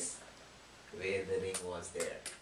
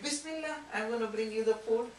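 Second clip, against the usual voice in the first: put-on high, light young girl's voice; calm obedient daughter answering; sweet daughter; 12 semitones higher, 5 dB louder than usual. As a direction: daughter calmly answering her angry father, high and light.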